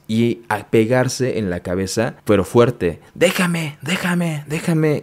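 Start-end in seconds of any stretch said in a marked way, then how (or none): 3.1-5.0 high, repeated frantic plea; high-pitched mock yell, no real volume; mock shouting. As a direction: imitate a frantic outburst, pitched way up. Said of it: "Pero él estaba gritando".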